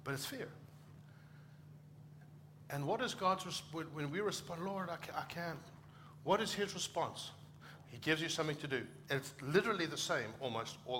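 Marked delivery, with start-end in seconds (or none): 4.6-5.6 higher, softer mock protest; timid reluctance. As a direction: shrink back in mild, acted self-doubt. Acts out our timid response.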